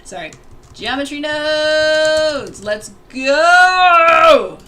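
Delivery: slow, drawn-out hyped yell; excited shout. Real, not acted. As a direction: cheer it out like a fan. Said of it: "I'm so excited".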